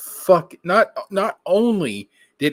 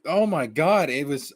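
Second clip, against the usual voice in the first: normal talking voice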